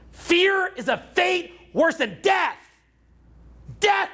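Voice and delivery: solemn motto. Acted. Motto repeated to listeners.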